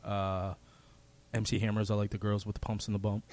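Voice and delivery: low, soft, deadpan tribute; mock solemn caller. Caller dedicating a song to mom, mock solemn. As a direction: pretend heartfelt dedication, kept quiet and grave.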